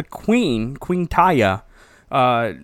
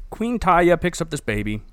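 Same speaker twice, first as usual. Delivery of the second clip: usual speaking voice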